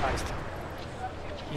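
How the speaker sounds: hushed, slow whisper while sneaking. Real, not acted. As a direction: breathe it so the guards won't hear. Sneaking past enemies in game. (susurro lento y sigiloso)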